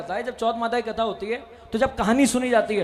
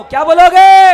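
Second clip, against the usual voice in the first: much higher and louder call; loud prompt; 7 semitones higher, 15 dB louder than usual. Real, not acted. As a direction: shout it to wake the crowd up. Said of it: "आवाज नहीं है कैथल वालों".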